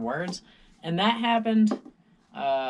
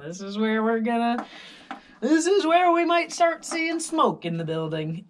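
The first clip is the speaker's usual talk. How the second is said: mock alarm; 11 semitones higher, 9 dB louder than usual. Fearing smoke in the building.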